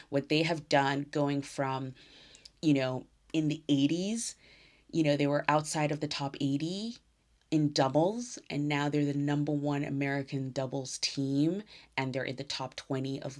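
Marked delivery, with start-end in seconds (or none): none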